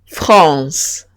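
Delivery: France with a nasal an and a French r. Shown as a demonstration.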